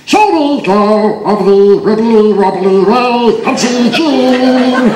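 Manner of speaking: high, slow wobbly puppet-bear voice; slow, high vibrato children's TV voice; sped-up tape voice. Shown as a demonstration. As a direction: do a little bear from a tape played too fast, with a wobble. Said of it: "It sounded something like this".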